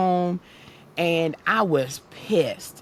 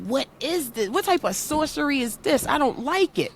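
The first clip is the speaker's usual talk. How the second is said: mock bewildered; 8 semitones higher than usual.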